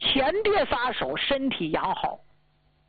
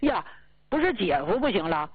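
ordinary talk

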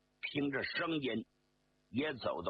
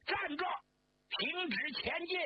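high, sharp shout to stop; shouted halt. Acted. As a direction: yell a command to halt at a distance. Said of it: "他马上高喊".

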